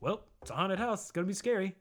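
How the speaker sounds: high, loud mock brush-off; dad brushing off scared kid, loud; dismissive dad. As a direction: wave it away, unimpressed. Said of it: "he seemed so kinda dismissive".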